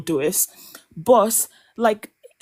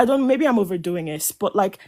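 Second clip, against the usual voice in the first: ordinary talk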